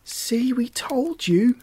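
high, slow, triumphant call; understated narrated shout; mild shout. A narrator's reading. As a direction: pitch it high and draw it out. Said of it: "shouted Lucinda and Erica together".

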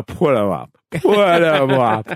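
lightly voiced Cowardly Lion, drawn out slowly; a hint of the Cowardly Lion daring a fight. Comparing Flair's taunt to the Cowardly Lion, slow and lightly voiced. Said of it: "the Cowardly Lion".

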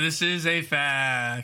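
very slow, stretched-out verdict; drawn-out announcement. Real, not acted. Host confirms the fact to player, drawn-out announcement. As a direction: stretch every word like a quiz host. Quiz host reveals the answer.

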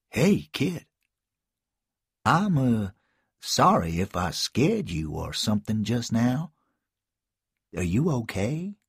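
a hint of a hesitant adult reaching out; Malcolm voice. Malcolm speaks to the boy.

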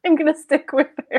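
laughing through the answer; amused laughing reply. Real, not acted. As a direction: giggle as you say it. Laughing while answering host.

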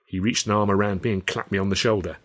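ordinary delivery, nothing marked

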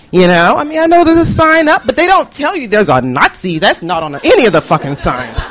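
normal talking voice

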